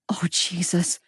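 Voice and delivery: breathless whispered gasp of dismay; shocked whisper. Acted. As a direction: whisper it through a hand over the mouth. Mam shocked, covering her mouth.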